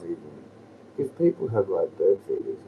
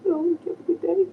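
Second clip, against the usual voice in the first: much higher and quick, sing-song; high bird-talk voice; 7 dB louder, faster than usual. Shown as a demonstration. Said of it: "Like that voice".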